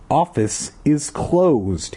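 very slow, unbothered repeat; same calm voice. Acted. Guard brushing off the kids, slow and unbothered. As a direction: drag it out, completely unconcerned. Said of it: "he repeated in the same calm voice".

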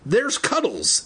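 high, drawn-out exclamation; crying out. A narrator's reading. Andy spots the missing hamster and points, crying out. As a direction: call it out high, stretching the words. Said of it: "Andy cried, pointing".

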